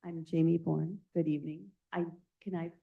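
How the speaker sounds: speaking up. Real, not acted. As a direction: project so the whole room can hear. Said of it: "Can you speak up, please?".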